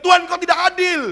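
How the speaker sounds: loud high indignant accusation; accusing cry. Acted. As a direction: hurl the complaint at God. Acted complaint at God.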